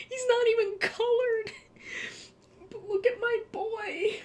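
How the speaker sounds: real joyful crying, barely holding together; crying happy tears. Real, not acted. Streamer moved to tears by her childhood robot, laughing through sobs. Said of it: "I'm sorry, I'm actually crying".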